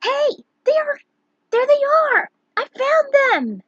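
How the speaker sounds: put-on very high, loud little girl's call; shrill girl. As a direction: shout like a thrilled little girl. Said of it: "a shrill voice was heard".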